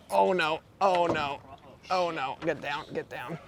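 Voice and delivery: urgent hushed pleading; hushed panic. Real, not acted. Fish running into mangroves.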